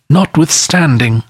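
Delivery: high, eerie ghost voice; unearthly voice. A narrator's reading. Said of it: "the spectre's voice disturbed the very marrow".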